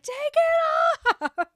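screaming demand. Retold or imitated. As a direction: explode and scream the order. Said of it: "and then she just screams".

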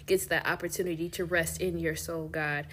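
soft, earnest prayer voice. Acted. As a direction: pray gently over the listeners. Leads a prayer.

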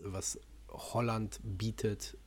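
ordinary talk

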